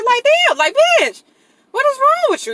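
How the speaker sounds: high, loud, fed-up yell; loud exasperated venting at girlfriend; exasperated outburst. Retold or imitated. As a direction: blow up at her in disbelief. Exasperated rant at texting girlfriend.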